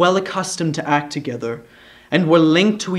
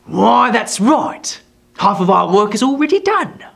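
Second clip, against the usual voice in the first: lightly voiced gloating dwarf; 7 semitones higher, 5 dB louder than usual. A narrator's reading. Quilp pleased with the pair, gloating. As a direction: savour the agreement.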